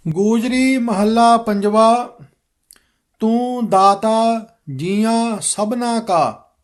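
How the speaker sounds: slow, measured recitation of scripture; careful model reading. Shown as a demonstration. Teacher modelling the shabad for students, slow careful recitation. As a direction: read it out slowly, every word distinct. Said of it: "ਸ਼ਬਦ ਸ਼ੁੱਧ ਕਰਵਾਵਾਂਗਾ".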